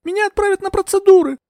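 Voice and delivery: very high, loud wail; high-pitched, desperate outcry; howled cry. A narrator's reading. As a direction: wail it out in panic. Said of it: "взвыла я".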